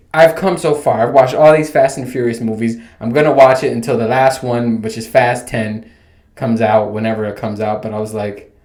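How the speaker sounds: ordinary talk, nothing marked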